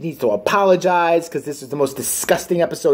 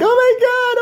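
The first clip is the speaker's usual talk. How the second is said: put-on screaming girlfriend. Mocking the girlfriend screaming over the body, a high shriek. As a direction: squeal it like a hysterical girlfriend. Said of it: "girlfriend's over there screaming like".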